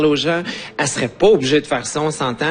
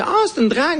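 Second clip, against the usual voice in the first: mocking onlooker; 12 semitones higher, faster than usual. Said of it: "Fait que, tu sais".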